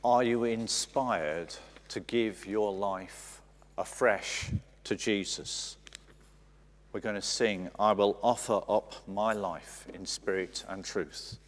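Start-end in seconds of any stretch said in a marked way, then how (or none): none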